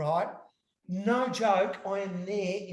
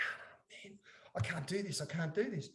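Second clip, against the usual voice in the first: hushed, worried muttering; whispered panic; 11 dB quieter than usual. Retold or imitated. Whispering to himself before the prank, nervous. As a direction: whisper the panic under your breath. Nervous retold self-talk.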